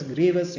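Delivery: "grievous" as GREE-vus, two syllables; correct pronunciation. Shown as a demonstration. Lecturer correcting students' mistake, correct pronunciation of "grievous" as GREE-vus. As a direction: say "grievous" without an "ee" before "-us". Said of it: "it should be pronounced as".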